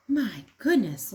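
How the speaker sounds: ordinary delivery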